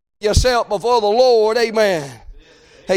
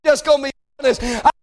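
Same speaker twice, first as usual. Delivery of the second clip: raised, insistent pleading to God; impassioned preached prayer; 5 semitones higher than usual. Acted. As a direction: cry out to God from the pulpit. Preacher prays aloud at force.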